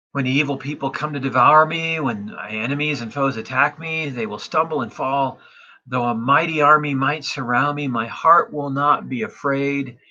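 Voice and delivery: psalm reading. Acted. Pastor reads psalm to congregation, confident proclamation. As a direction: proclaim the verses with conviction.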